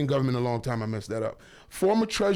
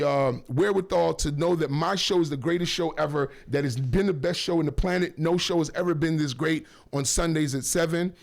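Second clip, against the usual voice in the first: usual speaking voice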